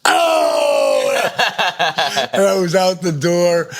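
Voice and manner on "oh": hollered "oh" with laughter. Retold or imitated. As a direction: imitate an uproarious reaction, laughing. Retold reaction to comeback, with laugh.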